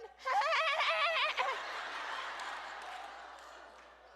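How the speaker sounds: mock howling laughter from the back seat; imitated mother-in-law laughing hard; high cackling laugh. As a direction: cackle high like she can't stop. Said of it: "she is in the back seat going".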